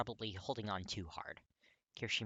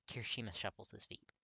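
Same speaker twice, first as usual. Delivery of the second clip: usual speaking voice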